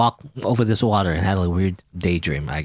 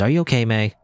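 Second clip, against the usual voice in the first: ordinary delivery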